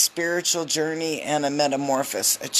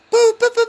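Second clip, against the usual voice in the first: mock fanfare; 10 dB louder, faster than usual. Tarot reader to viewers, mocking a miracle with a high trumpet-like boo. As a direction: trumpet out a big miraculous moment, much higher.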